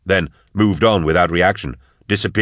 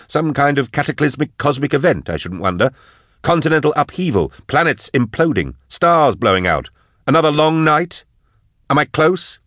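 high, heated list of disasters; sarcastic, agitated Drusha; 9 semitones higher than usual. Acted. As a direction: taunt him with each guess. Voices Drusha guessing sarcastically.

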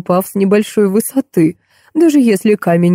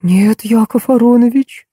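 forcing the words out; 5 semitones higher than usual. A narrator's reading. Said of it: "через силу произнесла Нина".

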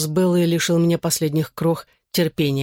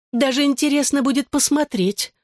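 much higher, mildly spiteful female voice; open spite; 10 semitones higher than usual. A narrator's reading. Bella dares her ex to try, openly spiteful. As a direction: taunt spitefully, pitched well up. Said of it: "с нескрываемой злобой".

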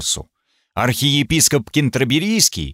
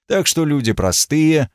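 ordinary talk, nothing marked

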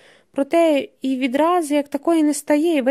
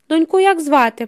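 raised, louder, higher-pitched question; sudden loud question; 4 semitones higher, 6 dB louder than usual. A narrator's reading. Pasha suddenly asks a stranger about his daughter, loudly. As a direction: cut in loudly and abruptly. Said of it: "питає раптом Паша голосно".

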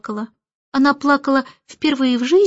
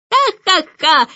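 higher, spelt-out bird guffaw; loud mocking laugh; 5 semitones higher, 4 dB louder, slower than usual. A narrator's reading. Starling laughing from the window, loud mocking laugh. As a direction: cackle out three derisive ha's. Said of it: "громкий смех Скворца".

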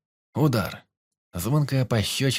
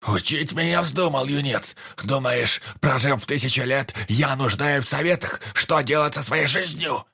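put-on outraged old elf, raised voice; angry; 5 semitones higher than usual. Old elf angrily snaps back.

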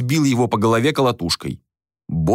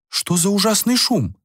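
high-pitched feminine question; acted lady's voice; startled lady; 10 semitones higher than usual. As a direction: ask in dismay about the racket. Lady appears, asking about the noise.

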